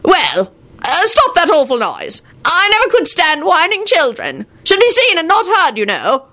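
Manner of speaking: put-on high-pitched scolding old man; old grandfather's high, indignant voice; crotchety old wizard.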